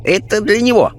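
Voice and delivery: high-pitched little sage; Chinese spirit guide. Acted. Chinese guide indicating the journalist, high-voiced. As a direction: toss it out high and brisk. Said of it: "китаец подмигнул им".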